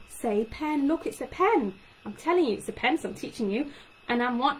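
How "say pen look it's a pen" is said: high, pushy Say pen; prompting a child to repeat. Shown as a demonstration. Speech therapist showing parents the wrong prompt, pushy. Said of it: "don't tell him or her to say".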